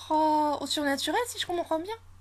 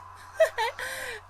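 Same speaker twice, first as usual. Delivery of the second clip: eerie laugh; 12 semitones higher than usual. Acted. Mysterious girl laughs strangely.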